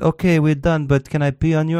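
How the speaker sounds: high-pitched Russian hooker impression; mock Russian woman. Imagined Russian hooker asking a client, mock woman's voice.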